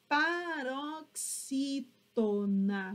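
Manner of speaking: paroxítona very slowly, pa - rox - í - tona; teacher sounding out paroxítona in long chunks; syllable by syllable. Shown as a demonstration. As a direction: draw out each syllable of paroxítona.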